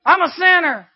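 high, loud cry of I'm a sinner; loud confession. Acted. Quoting thief's confession.

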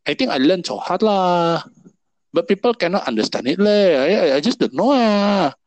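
whiny Singlish complaint, hammed up; mock Singlish. Friend imitating a frustrated Singlish learner, exaggerated.

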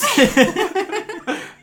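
high bursting laugh; loud laugh. Real, not acted.

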